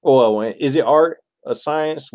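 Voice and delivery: ordinary talk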